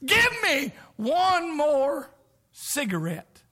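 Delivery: slow high strained plea; dying man's plea. Retold or imitated. Dying man on death's door begging, slow strained plea. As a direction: mimic a dying smoker begging. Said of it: "say something like this".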